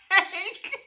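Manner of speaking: loud, high, laughing through the words; cracking up while telling it; breaking into laughter mid-sentence; laughing hard. Real, not acted. Laughing retelling nurse.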